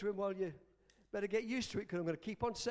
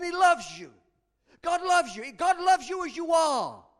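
high, loud, building proclamation; full-force preaching; 5 semitones higher, 7 dB louder than usual. Real, not acted. Preacher insisting on God's love to the downcast, impassioned proclamation. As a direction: drive each assurance home louder, to a peak.

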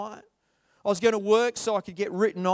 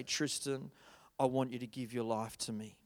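gentle voice of God; 8 semitones lower, 8 dB quieter than usual. Retold or imitated. God speaking tenderly in church.

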